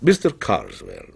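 low, hallowed intoning; reverent. A narrator's reading. Dealer savouring his customer's name, reverent. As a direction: pronounce the name like a benediction. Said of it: "as reverently as if the name was a benediction".

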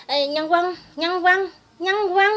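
"nhân quân" high and loud, mock Northern accent. Speaker ridiculing rival's pronunciation, mocking Northern accent on "nhân quân". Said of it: "nhân dân kêu".

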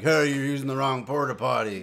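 mock scolding. Imagined attendant scolding a stranger, mock loud. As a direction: pretend attendant telling someone off.

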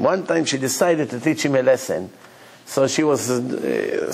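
ordinary talk

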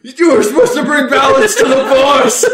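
high, very loud dramatic outburst; anguished mock shout. Player parodies a movie showdown as they grab the villain, anguished shout. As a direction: cry out in hammed-up betrayal.

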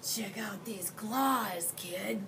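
a lightly rasped, slow monster boast; rasping monster. Acted. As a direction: show off the claws with a slow rasp. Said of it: "a third voice from under the bed rasped".

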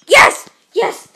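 high, very loud triumphant yell; loud shout of triumph. Real, not acted. Success in game.

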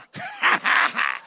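squealing high cackle; gleeful high-pitched cackle; high shrieking laugh. Acted. King laughing at Haman.

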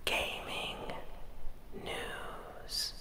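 steady ASMR whisper. Real, not acted. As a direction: whisper everything close to the mic. ASMR gaming whisper throughout.

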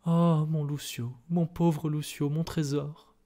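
high, drawn-out tearful wail; desperate sobbing. A narrator's reading. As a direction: lament through acted tears. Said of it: "éclata en sanglots désespérés". (sanglots désespérés)

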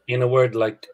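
ordinary delivery

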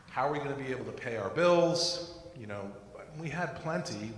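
usual speaking voice

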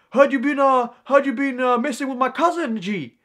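loud, high-pitched mock challenge; mock confrontation. Kids confront him over their cousin, aggressive mock challenge.

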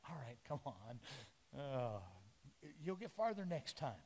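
low, soft, easygoing encouragement; gentle reassurance. Retold or imitated. The Lord gently encouraging a sinking Peter, soft and reassuring. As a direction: pat him on the back kindly. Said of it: "the Lord's just gotta be like".

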